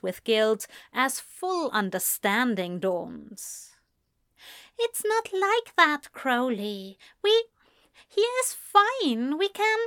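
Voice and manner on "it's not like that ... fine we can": high, earnest pleading; pleading angel. A narrator's reading. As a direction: plead with him. Aziraphale pleads.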